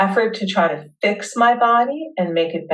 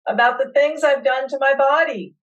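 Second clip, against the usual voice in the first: yelling it out; 6 semitones higher than usual. Real, not acted. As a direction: let the pain out in a yell. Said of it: "if you need to yell or moan, let it out".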